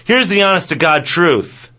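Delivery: ordinary talk, nothing marked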